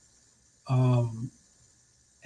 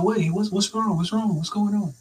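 higher-pitched, mildly anxious questioning; retold concern; 8 semitones higher than usual. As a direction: imitate worried relatives checking on a patient. Family hurrying worried to his bedside.

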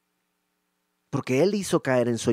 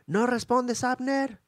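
high call across the valley; 10 semitones higher, slower than usual. Acted. David calling across to Abner, high and ringing. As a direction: shout to Abner far off.

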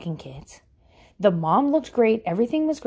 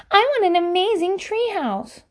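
mocking whiny homeowner; 10 semitones higher than usual.

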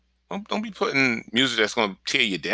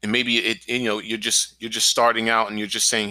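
normal talking voice

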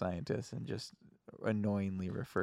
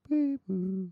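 high robotic beep boop; 10 semitones higher, 3 dB louder than usual. Retold or imitated. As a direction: chirp like a machine. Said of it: "actual detector is like".

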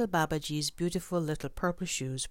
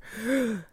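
frightened gasp; 8 semitones higher, 4 dB louder than usual. Acted. Boy hears tigers.

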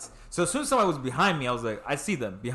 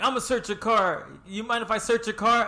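high-pitched questioning cop voice; imitated cop; 7 semitones higher than usual. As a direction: play the officer, pitched well up. Acts out cop asking to search.

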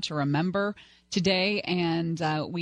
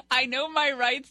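higher, indignant imitation; indignant mock quote; mocking the passenger; 7 semitones higher than usual. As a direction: sneer it like an entitled drunk. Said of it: "That man is gonna lose his job".

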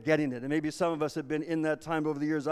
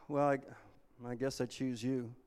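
reluctant choice; 3 semitones lower, 7 dB quieter than usual. Acted. Reluctant chooser, sighing.

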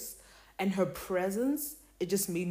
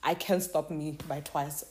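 ordinary talk, nothing marked

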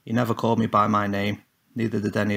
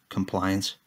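ordinary delivery, nothing marked

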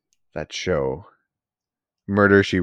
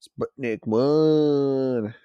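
drawn-out announcement; 5 semitones higher, 3 dB louder, much slower than usual. Acted.

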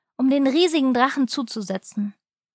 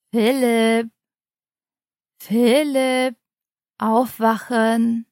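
father waking son; much slower than usual. Acted.

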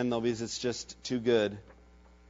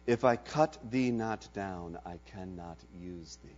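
lower, much softer, grave master; graver still; 5 semitones lower, 6 dB quieter than usual. Acted. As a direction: master telling beloved bamboo, quiet and grave. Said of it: "the master's voice grew graver still".

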